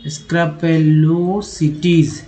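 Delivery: स्क्रपेलोसिटीज stretched out syllable by syllable, very slowly; slow word demo. Shown as a demonstration. Teacher sounding out the word for students, very slowly. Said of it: "next है स्क्रप्यूल".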